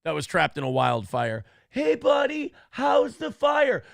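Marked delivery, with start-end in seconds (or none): 1.7-3.8 a hint of a high mocking greeting; mock Newsom. Host imitating Gavin Newsom greeting a dog, lightly mocking. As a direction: lightly ham up a politician's cheer. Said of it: "something Gavin Newsom would say".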